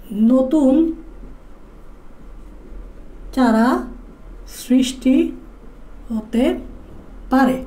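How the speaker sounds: each word set apart with long pauses; slow dictation. Shown as a demonstration. Teacher dictating a note to students, slow and spaced. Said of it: "teacher dictates note word by word".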